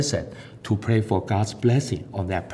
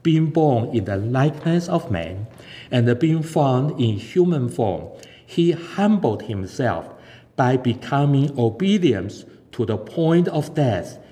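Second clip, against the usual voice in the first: measured, slightly raised reading of scripture; 3 semitones higher than usual. Acted. Preacher closing the sermon with Philippians, solemn reading. Reads Philippians chapter two.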